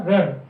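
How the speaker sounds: रर said as one syllable with a lengthened r; double r sound. Shown as a demonstration. Teacher quizzing the class, saying रर with a held r. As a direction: hold the r of रर. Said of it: "आर और आर से बनके क्या बना?".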